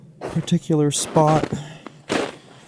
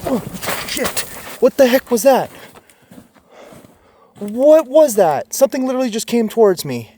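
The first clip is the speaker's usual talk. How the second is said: startled cries; 7 semitones higher than usual. Real, not acted. Startled by something charging him.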